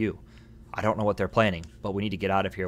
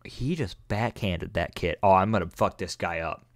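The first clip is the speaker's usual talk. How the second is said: ordinary talk, nothing marked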